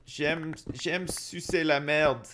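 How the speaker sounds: a light mock French accent. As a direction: drag out the French in a silly voice. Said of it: "speak to them in their native tongue".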